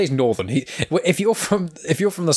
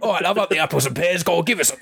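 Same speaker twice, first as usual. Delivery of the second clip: high-pitched mock Cockney patter; cheeky caricature of a Cockney accent; 7 semitones higher, faster than usual. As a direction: do a hammed-up Cockney. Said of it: "Or there's Cockney".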